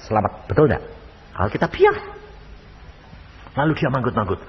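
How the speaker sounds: ordinary delivery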